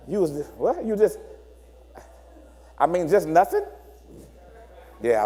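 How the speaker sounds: low, mumbled brush-off; evasive partner. Acted. Avoidant partner dodging questions after three days away. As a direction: shrug it off like it's nothing.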